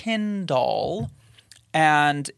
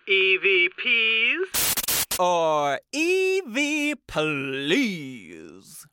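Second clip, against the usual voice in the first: unearthly voice; 4 semitones higher, much slower than usual. Pretend. Spooky intro to EVP segment.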